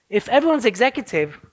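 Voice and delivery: normal talking voice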